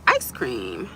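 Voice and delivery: low, drawn-from-the-chest comic impression; Eddie Murphy. Shown as a demonstration. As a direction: drop way down into a celebrity impression. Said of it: "Eddie Murphy voice".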